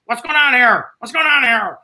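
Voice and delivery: mock indignant manager's outburst; Eldon. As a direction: demand an explanation as the manager. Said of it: "He goes back to the room".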